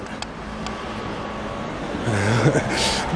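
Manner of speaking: quiet, breathy real laugh; low chuckle. Real, not acted. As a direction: laugh softly to yourself after the remark. Laughs after quoting the director.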